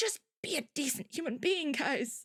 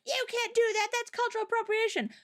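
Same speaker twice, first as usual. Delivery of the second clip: mock outraged scolding, pitched high; high-voiced mock indignation; losing their minds; 7 semitones higher than usual. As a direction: imitate an indignant online critic. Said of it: "people were losing their minds".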